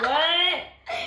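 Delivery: surprised loud what with a laugh; baffled what. Retold or imitated. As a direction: react like you can't believe it. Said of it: "I'm like".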